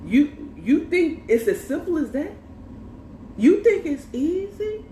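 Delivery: high-pitched, rising disbelief; incredulous challenge. Retold or imitated. Woman challenges man over his easy apology, incredulous.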